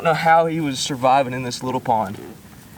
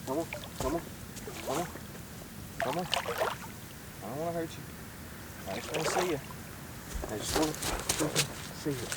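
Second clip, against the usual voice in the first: soft coaxing; 14 dB quieter than usual. Real, not acted. Coaxing the hooked fish closer.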